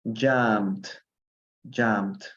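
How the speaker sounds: "jumped" as one syllable, the E silent; teacher's careful model of "jumped", no vowel in the ending. Shown as a demonstration. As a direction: say "jumped" like "jumpt". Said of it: "así sería la pronunciación".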